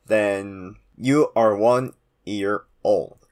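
ordinary talk, nothing marked

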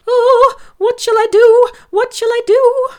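high, loud panicked piglet; frightened. Acted. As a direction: cry out in terror, trapped. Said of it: "shaking with fright".

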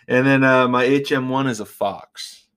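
ordinary talk, nothing marked

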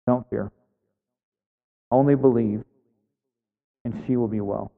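soft, tender voice of Jesus; calm him down gently; gentle reassurance. Retold or imitated. Jesus reassuring grieving Jairus, soft and tender. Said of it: "You can imagine him going".

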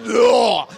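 loud strangled groan; loud winded groan; pained groan. Retold or imitated. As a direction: groan as if kneed in the groin. Groan after being hit.

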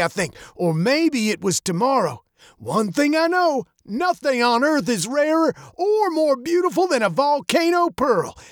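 put-on high, gravelly old man's voice. A narrator's reading. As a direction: spin a mysterious tale in an old, high voice. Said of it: "his voice as old and gravelly as his face".

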